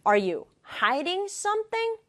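normal talking voice

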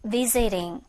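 "visiting" with the t turned to an r-like flap; American pronunciation. Shown as a demonstration. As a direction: say "visiting" like "visiring". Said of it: "얼 발음으로 미국식에서는 발음이 돼요".

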